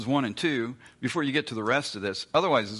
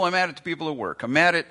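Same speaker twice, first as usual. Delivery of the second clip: fast, higher, building to a louder outburst; mock rising anger; 6 semitones higher, 5 dB louder, much faster than usual. Preacher acting out the listener's anger peaking, mock outburst. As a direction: let the gripe boil over. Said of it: "and we go".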